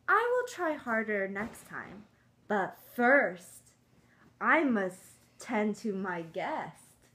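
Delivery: lively storybook read-aloud; reading to children. Acted. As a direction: read it like a picture book to kids.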